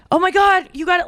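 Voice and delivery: high-pitched, eager retold exclamation; imitating excitement. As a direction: mimic an excited boyfriend. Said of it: "he was very excited".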